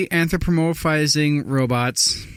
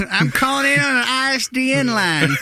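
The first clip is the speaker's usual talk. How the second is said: much higher, put-on country voice; 12 semitones higher than usual. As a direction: play the clueless caller. Mocking the radio caller.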